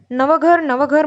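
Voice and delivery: put-on children cheering at the dinner table about the new house, shouting. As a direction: shout Navghar Navghar like kids cheering. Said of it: "म्हणून ओरडू लागलो".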